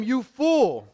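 loud, high preaching proclamation; stern rebuke. Acted. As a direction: thunder out God's rebuke. God's rebuke in the parable.

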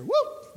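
high, loud joyful whoop; playful whoop of departure; gleeful whoop. Acted. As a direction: whoop like you're taking off. Joking about leaving for heaven.

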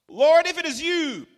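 Peter calling out. Acted. Voicing Peter.

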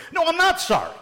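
high-pitched mock tantrum shout; acted, exasperated yell jumping way up; defiant outburst. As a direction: blurt it out like a fed-up kid. Acted child's outburst ending the quarrel.

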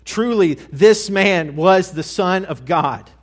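loud, high, slow cry of realization; shrieking proclamation. Acted. Centurion at the cross crying out his realization, loud and high. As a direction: shout it out as the truth hits him. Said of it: "and he shrieks".

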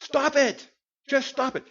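high, loud parent's yell; scolding yell. Retold or imitated. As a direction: snap at them like a fed-up parent. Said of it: "you've yelled at them with that tone".

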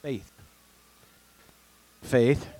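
usual speaking voice

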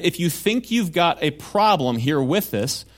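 ordinary talk

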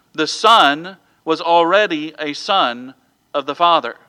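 emphatic preaching. Real, not acted. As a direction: drive each point home to the congregation. Preacher drives points home.

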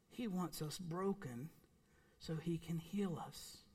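quiet emphatic aside; hushed. Real, not acted. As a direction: drop to an intimate hush. Preacher drops to a hush.

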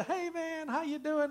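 high-pitched, sing-out hello; acted upbeat hallway hello; friendly greeting. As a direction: greet a student cheerily, voice way up. Acting out friendly school hallway greeting.

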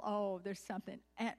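ordinary talk

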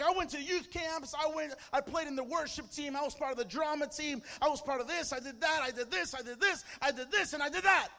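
imitating a worked-up rant, shouted high; ranting list. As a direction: reel it off loudly, building to a mock yell. Retold scoffer's ranting list.

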